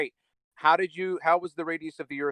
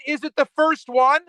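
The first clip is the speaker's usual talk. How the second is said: loud, high, fed-up repeat; exasperated shout; 9 semitones higher, 7 dB louder than usual. Real, not acted. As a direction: yell the question back at him.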